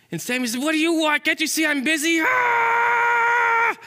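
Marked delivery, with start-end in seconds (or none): 0.6-2.2 high, stressed-out squirrel outburst; angry, exasperated. Acted. Squirrel too busy for God, angry outburst. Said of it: "Just busy being angry". 2.2-3.8 long, very high scream; angry scream. Acted. Squirrel tells God he's busy, angry scream. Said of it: "busy being angry".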